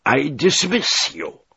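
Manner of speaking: slow, high, loud angry pronouncement; choking with anger. A narrator's reading. Commodore sacking the defiant delegate, choking with anger. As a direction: fire them angrily, drawing out every word. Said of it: "krztusił się ze złości".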